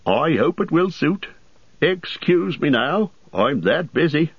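high, chatty landlord voice; an overworked host rattling on; bustling innkeeper. A narrator's reading. Landlord hurries off.

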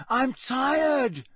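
loud, high fed-up shout; bellowing. Acted. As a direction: shout it over the crowd. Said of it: "he bellowed finally".

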